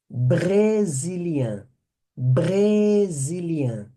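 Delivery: brésilien slow, with the R scraped in the throat; teacher drilling brésilien's throat R; throaty French R. Shown as a demonstration. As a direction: drag out brésilien with a guttural R. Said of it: "esse R é um R aqui bem na garganta".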